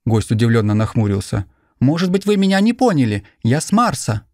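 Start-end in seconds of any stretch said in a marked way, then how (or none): 1.8-4.2 put-on high, insistent young stranger; pleading to be believed; earnest young man. A narrator's reading. Martian insists.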